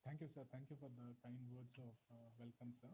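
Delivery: very faint, far from the mic; barely audible. Real, not acted. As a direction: voice fading out, hard to hear. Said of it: "Sir, your voice is very low".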